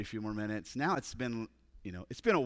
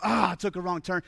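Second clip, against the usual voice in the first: exasperated outburst, higher pitched; acted anger; 5 semitones higher, 5 dB louder than usual. Hiker mad at himself for a wrong turn, acted anger. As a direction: act out being mad at yourself. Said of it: "He's, he's angry".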